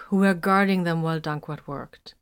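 ordinary delivery, nothing marked

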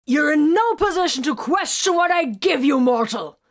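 loud, high-pitched furious outburst; sudden roar. Acted. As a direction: roar it at full force, putting them in their place. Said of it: "Vox suddenly roared".

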